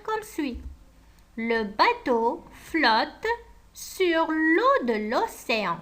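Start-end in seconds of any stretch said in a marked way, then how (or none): 1.4-5.8 slow dictation. Acted. Dictating the final sentence.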